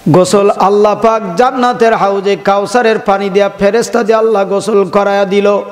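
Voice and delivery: ordinary talk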